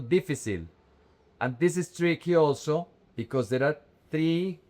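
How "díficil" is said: difícil with the stress moved onto "di", falling after it; mis-stress difícil on its first syllable; difícil said as DEE-fi-seel; wrong first-syllable stress. Shown as a demonstration. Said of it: "It's not difícil".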